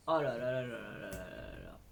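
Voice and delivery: low soft swooning oh là là. Real, not acted. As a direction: melt over the cardigan, hushed. Swooning over a cardigan.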